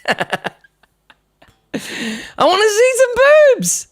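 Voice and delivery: high, slow cartoon squeal; hormone voice. The hormones begging the teen, high slow squeal. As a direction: drag it out like a lusty gremlin.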